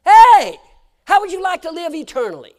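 high, loud preacher's call; preaching shout. Acted. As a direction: hit the congregation with it. Preaching at full force.

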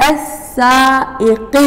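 السائق slowly, hamza on a kasra seat; careful pronunciation. Shown as a demonstration. Teacher showing the hamza in السائق. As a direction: stretch السائق, stress the hamza. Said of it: "دائمًا جوا الكرسي ش-، أكو كسرة".

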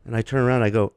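ordinary talk

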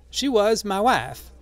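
high, slow character answer; old man Drioli. A narrator's reading. Old man telling the crowd about his wife, high and slow. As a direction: raise the pitch and stretch it out.

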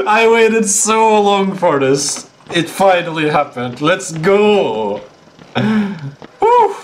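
high, drawn-out triumphant cheer; thrilled hype; real excitement. Real, not acted. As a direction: celebrate it, stretching the words high. Celebrates the long-awaited moment.

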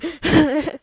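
loud, high burst of laughter; high, loud giggle; short laugh. Real, not acted. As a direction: laugh out after the wail. Laughs after losing.

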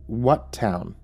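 plain reading voice

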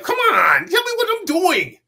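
high, loud mock wail; exasperated pleading. Actor pleading with secretive producers, wailing loudly. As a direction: beg at a shout, pitch climbing. Retold begging to be told.

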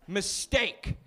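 normal talking voice

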